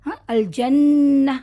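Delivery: الجنہ slowly drawn, ending in a breathy ہ; pausal recitation. Shown as a demonstration. Teacher showing the pause on الجنہ to students. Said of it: "ہا نکل رہا ہے".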